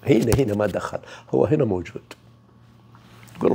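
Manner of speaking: ordinary talk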